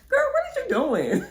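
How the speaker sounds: high, loud, laughing disbelief; incredulous shout. Retold or imitated. Catching a friend giggling under covers, loud high shout. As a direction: yell it at your friend caught in bed. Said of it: "And I'm just like".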